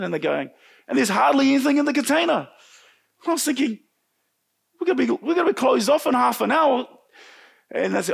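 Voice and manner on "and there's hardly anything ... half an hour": high, urgent worried report; pressured re-enactment. Retold or imitated. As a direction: relive the looming deadline, pitched up. Re-enacting deadline pressure at work.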